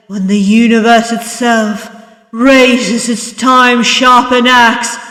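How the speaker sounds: dramatic narration swelling to a forceful peak; building intensity. A narrator's reading. Dark prologue building to its climax.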